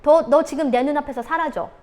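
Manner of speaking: 엄하게 명령하는 엄마; harsh command. Shown as a demonstration. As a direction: order the child, no room for talk. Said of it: "이런 식으로".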